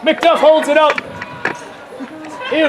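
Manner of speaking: usual speaking voice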